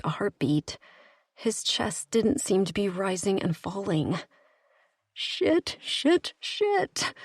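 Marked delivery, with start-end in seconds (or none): 5.1-7.1 high, thin whimpering curses. Acted. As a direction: whimper it out in panic. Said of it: "I whimpered".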